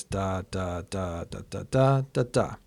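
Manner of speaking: "da" syllables beating long, long, long, short, short, long, short, long; measured "da, da" meter with quick pairs and held longs; rhythm demonstration. Shown as a demonstration. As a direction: sound out the whole glyconic line on "da". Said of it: "so it sounds like this".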